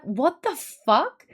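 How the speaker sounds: slow, drawn-out mock disbelief; imitated bafflement. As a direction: imitate a stunned young coworker, rising at the end. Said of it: "The young one was like".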